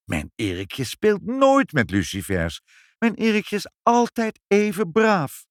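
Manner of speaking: indignant defence, rising high by the end; protesting. A narrator's reading. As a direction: insist stubbornly, pitch climbing in protest. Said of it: "bleef ze steeds maar protesteren". (verontwaardigd protesterend)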